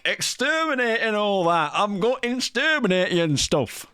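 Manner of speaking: playful Dalek impression; mock Dalek. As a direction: play a ranting Dalek robot. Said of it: "And he was like".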